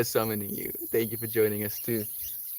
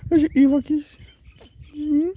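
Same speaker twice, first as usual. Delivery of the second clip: very high loud cooing; baby talk; 14 semitones higher, 7 dB louder, faster than usual. Acted. Streamer cooing at her cat for a kiss, very high.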